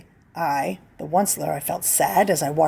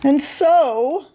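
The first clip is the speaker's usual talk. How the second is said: higher, louder Lorax; 7 semitones higher, 4 dB louder than usual. Acted. Lorax pleads with the Onceler, higher voice. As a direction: start the Lorax's plea. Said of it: "said the Lorax".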